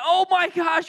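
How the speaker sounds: high, alarmed mock outburst; high-pitched comic freak-out; acted panic. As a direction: panic like a kid caught by mom. Friends panicking as mom bursts in.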